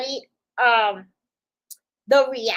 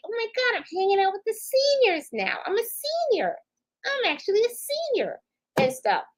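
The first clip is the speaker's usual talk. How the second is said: much higher, hammed-up disbelief; mock shock; 11 semitones higher than usual. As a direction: play-act horror at being old. Mock dismay at being senior.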